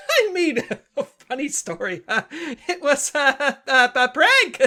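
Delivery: laughing awkwardly to cover a slip; nervous laughing. Acted. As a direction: stammer through nervous giggles.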